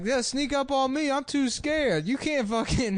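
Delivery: high scared comic voice; Steve Harvey impression. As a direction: panic in a high voice. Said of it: "He's like".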